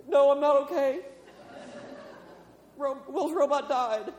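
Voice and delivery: high, loud hammed-up wail; mock tearful outburst. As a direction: wail like an overwrought fan, played for laughs. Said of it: "I'm trying not to cry".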